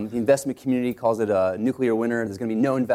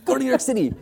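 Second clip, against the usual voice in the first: much higher insistent command with a laugh; Paul Graham command; 12 semitones higher, faster than usual. Retold or imitated. Mentor ordering founders to New York, high laughing imitation. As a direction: point and order them out. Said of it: "He, he points at you like this".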